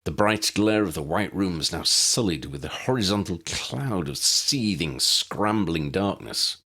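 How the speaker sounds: ordinary delivery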